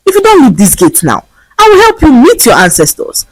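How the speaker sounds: high threatening warning; warning threat. A narrator's reading. Gateman Kunle threatening the beggar with a stick. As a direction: threaten her off the gate. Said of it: "as he warned her to stay away".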